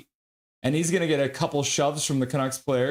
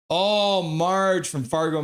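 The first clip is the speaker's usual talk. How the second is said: ordinary talk, nothing marked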